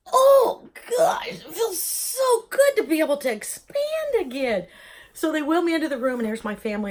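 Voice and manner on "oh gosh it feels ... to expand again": much higher, louder gasping cry of relief, slowed; relieved lungs. Pretend. Her own lungs rejoicing at breathing again, high relieved cry. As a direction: voice her lungs sighing with joy as they expand. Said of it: "my lungs are in there going".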